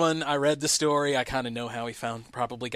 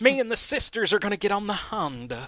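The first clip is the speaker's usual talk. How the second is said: preacher voice; 6 semitones higher than usual. Acted. Continues televangelist bit.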